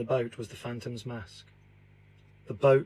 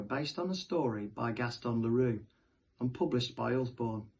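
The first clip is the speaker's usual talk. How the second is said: ordinary delivery, nothing marked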